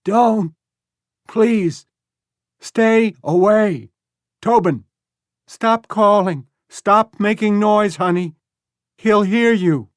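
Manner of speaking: high, loud, slow desperate pleas; frightened shouting. A narrator's reading. Woman warns strangers and hushes her son, frightened shouting. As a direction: beg them to stay away, terrified. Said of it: "shouted the woman".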